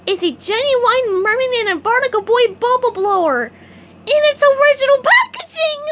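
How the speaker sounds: excited. A narrator's reading. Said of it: "trembled with excitement".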